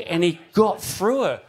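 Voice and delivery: usual speaking voice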